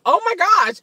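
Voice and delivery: high, loud gasp of surprise; surprised. Real, not acted. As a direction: blurt it out in shock. Reacting to tripolar remark.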